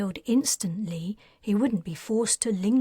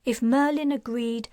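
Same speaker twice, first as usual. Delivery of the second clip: usual speaking voice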